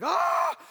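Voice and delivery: sudden, very high shriek; startled yelp of fright. Acted. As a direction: jump back in shock. Startled onlooker at dead man rising.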